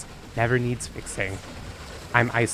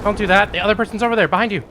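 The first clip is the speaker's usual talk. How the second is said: raised, higher urgent warning; urgent call-out; 7 semitones higher, 5 dB louder, faster than usual. Real, not acted. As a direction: call it out fast to your teammate. Warning teammate mid-fight.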